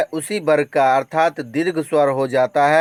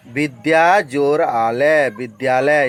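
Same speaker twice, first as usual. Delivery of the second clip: slow dictation. Shown as a demonstration. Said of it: "teacher gives example".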